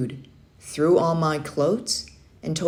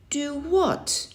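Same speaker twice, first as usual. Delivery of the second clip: puzzled; 10 semitones higher than usual. Acted. Boy puzzled question.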